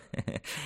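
very soft, short snicker; a quiet little laugh to himself; sly chuckle. Acted. Physician amused by his own jibe, soft sly chuckle.

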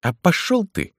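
high-pitched cry without full volume; narrator's contained high yell; angry cry. A narrator's reading. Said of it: "закричал на Жору одноглазый Джо".

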